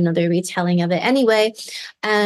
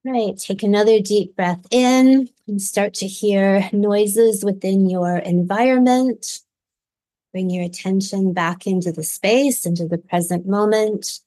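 guided meditation. Acted. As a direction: gently guide listeners through the visualization. Leads a guided visualization.

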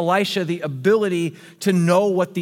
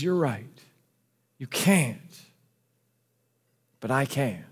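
softer and lower, gently reassuring; loving; 4 semitones lower, 3 dB quieter than usual. Acted. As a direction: speak it tenderly, like a father comforting. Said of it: "he lovingly says".

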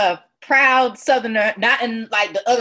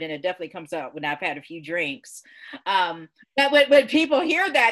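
usual speaking voice

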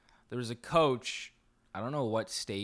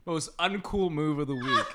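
laughing; 5 semitones higher, 6 dB louder than usual. Real, not acted. Reading through laughter.

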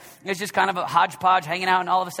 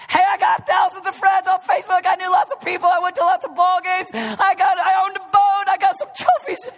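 higher and loud, reeling off brags; hammed-up boaster; 11 semitones higher, 7 dB louder than usual. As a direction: show off each achievement. Imagined boaster at judgment.

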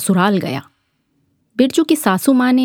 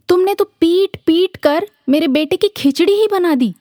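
scolding mother; 9 semitones higher than usual. Acted. Mother scolds hotel men.

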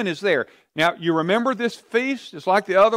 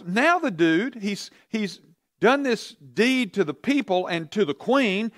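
ordinary talk, nothing marked

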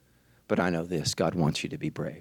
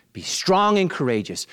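louder, higher second call; rising exhortation; building up; 9 semitones higher, 6 dB louder than usual. Acted. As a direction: push harder this time.

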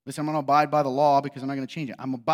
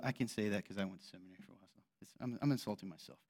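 quiet aside; 9 semitones lower, 15 dB quieter, faster than usual. Real, not acted. Self-deprecating aside.

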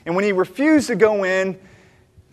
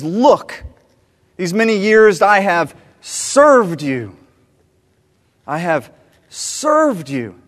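resentful; 5 dB louder, much slower than usual. A narrator's reading. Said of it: "He's very disrespectful".

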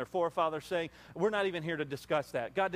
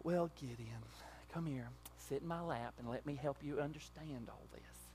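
much softer, cooing comfort; mock coddling; 3 semitones lower, 12 dB quieter than usual. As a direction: pretend to baby him. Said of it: "He didn't even say".

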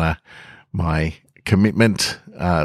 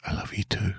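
breathy whispered reply, much softer; 2 semitones higher, 10 dB quieter than usual. Acted. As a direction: breathe it into her shoulder. Muffled reply in an embrace.

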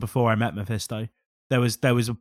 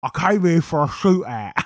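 Ronnie Kray voice; 5 semitones higher than usual. Retold or imitated. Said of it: "Tom Hardy doing Ronnie, Ronnie Cray".

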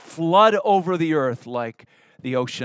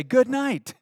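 exasperated; 8 semitones higher than usual. Real, not acted. Exasperated exclamation at rude friends.

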